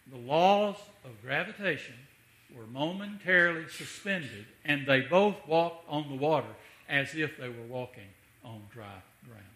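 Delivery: loud ringing sermon proclamation; preacher's swelling declaration; full-force preaching. Acted. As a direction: proclaim it to the back pew.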